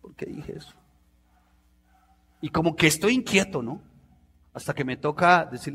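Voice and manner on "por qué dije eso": whispered regret to himself; remorseful whisper. Retold or imitated. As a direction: breathe it out, ashamed of what he said. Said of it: "yo digo". (susurro arrepentido)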